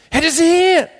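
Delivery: loud, very high cry of recognition; excited exclamation. Acted. Disciple tells Peter who it is, excited cry.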